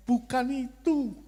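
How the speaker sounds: high, slow, firm divine voice; hammed-up God saying no, slowly and high. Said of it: "Tuhan ngomongnya". (suara Tuhan yang tinggi dan pelan, dibuat-buat)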